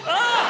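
sudden high yelp of a sneeze; hammed-up sneeze shriek; mock sneeze yelp. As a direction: burst out with a squeaky sneeze cry. Sneezing on a train.

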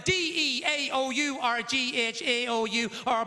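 slow letter-by-letter recital; long-winded spelling. Acted. Comedian asked to spell a name, slow recital. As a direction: reel off every letter deliberately, on and on. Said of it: "How do you spell your name?".

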